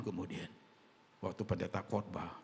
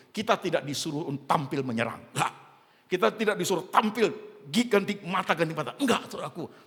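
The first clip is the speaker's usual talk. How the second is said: preaching at full force; 9 semitones higher, 11 dB louder than usual. Acted.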